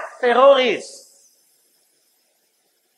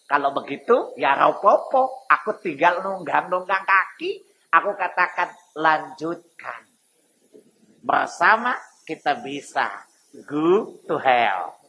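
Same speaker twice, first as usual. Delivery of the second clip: lower, softer, unhurried devil's drawl; smug Iblis; 7 semitones lower, 3 dB quieter than usual. Acted. Preacher plays a smug Iblis, lower and softer. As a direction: let the devil shrug it off slyly. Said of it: "Maka iblis bilang apa?".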